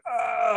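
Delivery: high gasp, still pretending; one last put-on gasp; fake gasp. Boy keeps up the fake gasp as they run. Still faking till they're gone.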